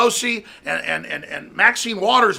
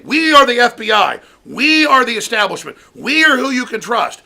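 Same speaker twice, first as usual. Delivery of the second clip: mock FBI voice; 4 semitones higher, 6 dB louder than usual. Mocking FBI establishment voice.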